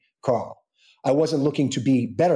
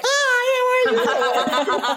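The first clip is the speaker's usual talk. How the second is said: very high, laughing wave hello; imitating wife's cheery call across the room; a bubbly hammed-up hello; much slower than usual. Wife waving across restaurant.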